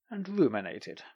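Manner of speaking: ordinary delivery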